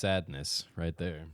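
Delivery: ordinary delivery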